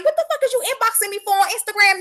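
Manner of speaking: usual speaking voice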